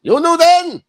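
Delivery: high put-on voice, laughing; silly high voice. Joking with friends in a silly high voice. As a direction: squeak it out for fun. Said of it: "we talking like this".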